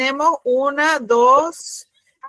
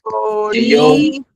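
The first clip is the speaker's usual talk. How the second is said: louder, long-held syllables; slow dictation; 5 dB louder, much slower than usual. Shown as a demonstration. Teacher repeating a student's word, louder and drawn out. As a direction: draw the word out firmly.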